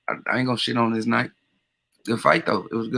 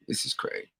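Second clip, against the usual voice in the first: muttered; 9 dB quieter than usual. Real, not acted. Muttering aside after going mute.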